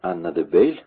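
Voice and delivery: usual speaking voice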